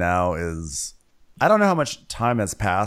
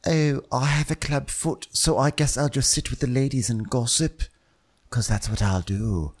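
put-on voice; 3 semitones higher than usual. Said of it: "he was like".

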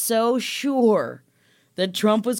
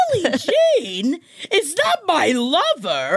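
high, slow, mock-indignant quote; hammed-up angry mother drawing out each word; imitating mad mom; 7 semitones higher, slower than usual. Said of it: "Why are you so mad?".

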